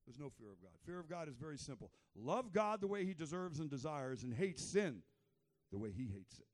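ordinary talk

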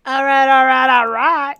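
loud, high rallying call; hollered catchphrase. Pretend. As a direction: belt it out to get moving.

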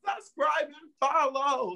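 high, slow sing-song subscribe and follow; high drawn-out sign-off; drawn-out plea. Acted. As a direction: stretch it out high like a chant.

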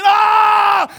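long, loud, high scream; high-pitched yell of panic; panicked scream. Acted. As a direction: scream out in terror at full volume. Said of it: "some are screaming".

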